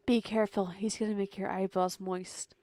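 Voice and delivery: very loud booming warning; loud hammed-up hype voice; mock warning shout. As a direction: bellow the warning like an ad.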